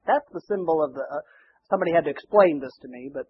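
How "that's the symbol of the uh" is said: normal talking voice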